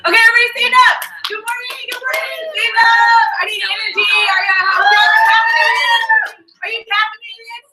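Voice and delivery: loud, high hype-man wake-up call; booming motivational speech. Real, not acted. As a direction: pump up the room like a morning coach. Hyping up a morning crowd.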